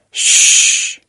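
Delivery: a loud hissed "嘘"; shushing. A narrator's reading. The audience shushes Dodds, sharp hiss. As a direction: hush him sharply. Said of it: "周围的人都朝他抗议起来".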